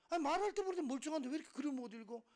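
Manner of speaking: high, loud, rapid scolding; acting out a teacher's loud reproach; exasperated teacher. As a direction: tear into a student at speed. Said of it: "선생님들이".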